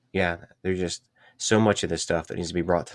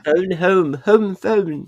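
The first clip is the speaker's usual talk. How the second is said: high, loud put-on alien voice; film alien imitation; 12 semitones higher, 6 dB louder, much slower than usual. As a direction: squeak it out as a joke alien. Quoting the film alien as joke.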